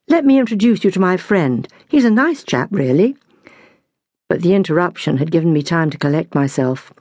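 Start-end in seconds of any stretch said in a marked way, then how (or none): none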